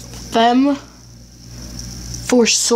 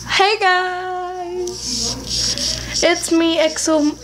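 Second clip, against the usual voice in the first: high, sing-song YouTuber hello; upbeat channel intro call; hyped intro greeting; 7 semitones higher, much slower than usual. Acted. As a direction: open the video like a bubbly host.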